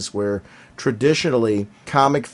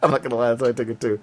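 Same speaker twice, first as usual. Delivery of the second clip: I'm not gonna lie rushed through laughter; fast laughing; much faster than usual. Real, not acted. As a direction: rattle it off while laughing.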